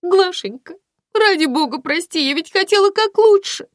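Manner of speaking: very high, loud tearful apology; sobbing plea. A narrator's reading. Violetta begs Aglaya's forgiveness, tearful. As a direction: beg forgiveness in a high wail. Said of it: "потом Виолетта всхлипнула".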